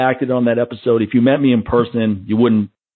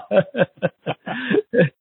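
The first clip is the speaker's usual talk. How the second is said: high laugh; 12 semitones higher, 4 dB quieter than usual. Real, not acted. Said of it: "laughing at the joke".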